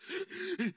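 imitated hiccupping sobs, quiet and high; child's sobbing gasps. Preacher imitating a child after long crying, gasping sobs. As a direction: catch the breath like a cried-out child. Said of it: "child before who have cried and cried".

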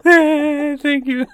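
high, loud, squeaky thank-you with a laugh; laughing high-pitched thanks; mock delighted thanks. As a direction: play it giddy and flattered, laughing through it. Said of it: "I'm like".

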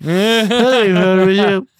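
mock cheerful. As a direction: play it over-the-top chipper while wearing gloves. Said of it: "I'm like".

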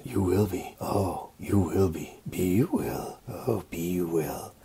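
low, soft creepy Yoda croak. Retold or imitated. Yoda warning Luke in the cave, low and creepy. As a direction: creep it out like a little swamp master. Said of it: "that's when Yoda gets creepy and goes".

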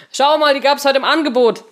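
high, loud triumphant call; triumphant announcement. A narrator's reading. Henry arriving with snacks, triumphant announcement. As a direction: announce a bargain find with triumph. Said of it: "verkündete er triumphierend".

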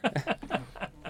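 a real laugh, up high and soft; high, soft, amused laugh. Real, not acted. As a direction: laugh off the embarrassing memory. Player laughs at own story.

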